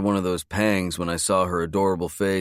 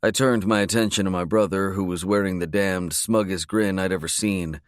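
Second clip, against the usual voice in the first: ordinary delivery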